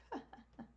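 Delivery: soft, self-amused chuckle; small laugh at oneself; real laugh. Real, not acted. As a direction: laugh quietly at your own blunder. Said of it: "I'm laughing so hard at myself".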